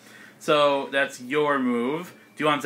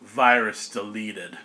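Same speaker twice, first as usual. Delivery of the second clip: slow, lower catchphrase; delivered catchphrase; 6 semitones lower, slower than usual. Acted. Game master feeding a player Zap Zap's kill line, slow and low. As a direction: say it slowly and low as a finisher. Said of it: "can I give you a line?".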